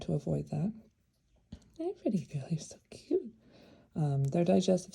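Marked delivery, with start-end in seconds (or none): 1.8-3.3 high, hushed cooing; soothing a pet. Real, not acted. Cooing to the bird.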